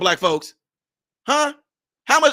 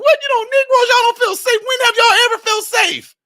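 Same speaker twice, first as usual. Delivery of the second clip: much higher, loud, sneering mock disbelief; high jeering voice, louder; mocking laugh voice; 4 dB louder than usual. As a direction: play the ones laughing at them, shrill and scornful. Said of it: "They're like".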